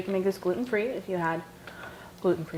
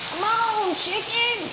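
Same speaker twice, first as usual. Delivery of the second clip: calling out; 11 semitones higher, 3 dB louder, much slower than usual. Real, not acted. Urging chicken while cooking.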